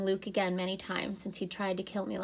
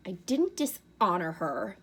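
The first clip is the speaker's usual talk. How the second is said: snapped; 4 dB louder than usual. A narrator's reading. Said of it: "Luke snapped".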